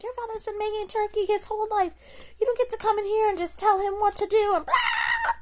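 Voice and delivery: high-pitched mock of mom scolding; imitating raging mom. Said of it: "my mom lost her mind".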